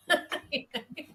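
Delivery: a short high burst of laughter. Real, not acted. Nurse laughing at the see-treat-street joke, high laugh.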